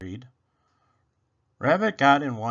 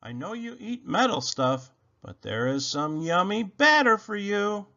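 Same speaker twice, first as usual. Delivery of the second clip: a touch higher, sweet coaxing voice; coaxing Rabbit; 6 semitones higher than usual. A narrator's reading. Rabbit tempting Robot with batter, lightly coaxing. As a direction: tempt him gently with the treat.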